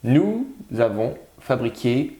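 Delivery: slow dictation, word by word. Shown as a demonstration. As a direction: leave a gap after each word. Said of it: "On cherche le quatrième mot".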